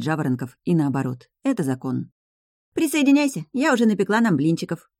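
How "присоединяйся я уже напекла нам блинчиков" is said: high bubbly invitation; cheerful. A narrator's reading. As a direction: invite them in, all sunshine. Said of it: "всё так же позитивно сообщила Инесса".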